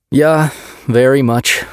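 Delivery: put-on slow, wistful Swedish accent. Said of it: "his Swedish accent thick".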